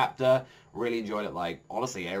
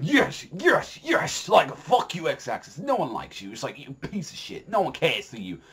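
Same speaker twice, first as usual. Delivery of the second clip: gleeful cheering; 4 semitones higher than usual. Retold or imitated. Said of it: "all I'm there just going".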